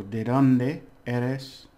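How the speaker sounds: "¿De dónde eres?" said very slowly, stress on "dónde"; slow model phrase. Shown as a demonstration. Teacher modelling "¿De dónde eres?" very slowly.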